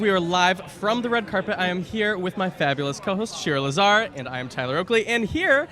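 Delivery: bubbly red carpet host intro; bright, excited welcome to viewers; gushing red carpet chatter. Acted. Host opens red carpet segment.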